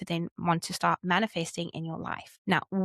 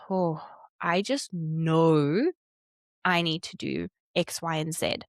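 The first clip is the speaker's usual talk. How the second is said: calm clarity; 3 semitones lower, slower than usual. Retold or imitated.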